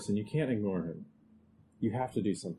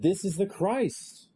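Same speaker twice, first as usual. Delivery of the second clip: higher, louder, slow declaration; emphatic proclamation; 9 semitones higher, 5 dB louder, slower than usual. Retold or imitated. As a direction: announce it like big news. Quoting others proclaiming Jesus.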